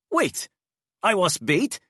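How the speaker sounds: high, startled demand; indignant surprise. Acted. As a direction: blurt it out in disbelief. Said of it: "demanded Enrique".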